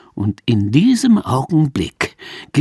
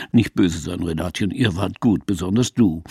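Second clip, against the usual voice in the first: lower, soothing actor's voice; lower, gently teasing actor; Manfred Krug; 7 semitones lower, 3 dB quieter than usual. Acted. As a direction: coax her kindly, low. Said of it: "den wir als Manfred Krug erkennen".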